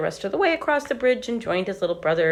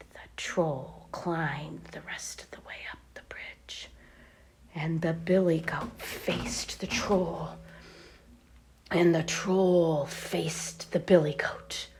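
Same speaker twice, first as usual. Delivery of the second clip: slow whispered narration; hushed storyteller before the fight; suspense whisper; 5 dB quieter, slower than usual. A narrator's reading. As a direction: whisper to build the tension.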